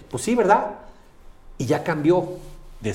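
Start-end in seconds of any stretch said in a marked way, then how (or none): 0.1-0.8 agreeing friend. Retold or imitated. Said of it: "la persona dice".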